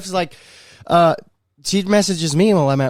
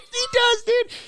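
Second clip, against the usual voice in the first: squeaky high; slower than usual. Real, not acted. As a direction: voice jumps way up high. High exclaim agreeing.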